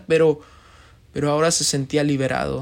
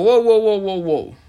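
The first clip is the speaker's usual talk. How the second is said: startled, higher and louder wows that drop away; frightened; 4 semitones higher, 4 dB louder than usual. A narrator's reading. A frightened man backing away from the angel. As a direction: recoil in alarm, then settle. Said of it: "el hombre se asustó y le dijo".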